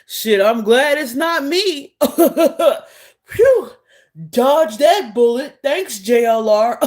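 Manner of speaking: mock chuckling with relief, loud phew; gloating, relieved laugh put on in imitation; imitating relieved laughter. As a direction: act out laughing at a narrow escape. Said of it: "he was laughing out of relief".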